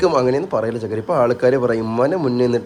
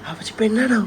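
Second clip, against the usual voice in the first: put-on cooing baby talk; 8 semitones higher, 4 dB quieter than usual.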